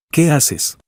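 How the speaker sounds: a sudden alarmed question. A narrator's reading. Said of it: "Makoto se alarmó". (pregunta alarmada, más aguda)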